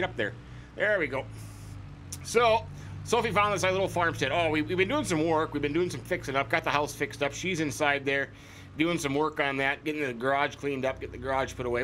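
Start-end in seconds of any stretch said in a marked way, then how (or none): none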